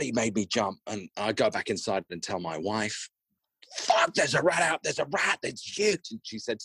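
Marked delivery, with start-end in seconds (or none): 3.8-6.1 high, rushed alarm at the rat, retold; alarmed rat warning. Husband bursting in to tell his wife about the rat, alarmed and high. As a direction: blurt it out as if just spooked.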